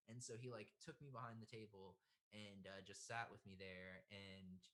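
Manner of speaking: ordinary talk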